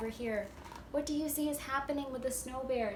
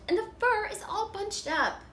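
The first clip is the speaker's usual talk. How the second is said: much higher, mock-huffy mother bear; huffy Mama Bear; 8 semitones higher, 6 dB louder than usual. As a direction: huff like an annoyed mama bear. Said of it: "she huffed".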